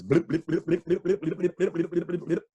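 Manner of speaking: rapid, repeated blubbering licks; dog licking noises. A narrator's reading. Storyteller imitating dogs licking faces, quick blubbery slurps. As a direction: imitate sloppy licking with the tongue. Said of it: "The dogs licked people's faces".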